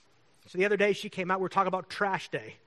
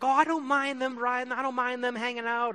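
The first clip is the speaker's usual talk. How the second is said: higher, louder, breezy mock voice; imitating neighbor; 9 semitones higher, 5 dB louder than usual. Neighbor talking about homeless people riding through, higher breezy mock voice. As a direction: toss it off lightly in her voice.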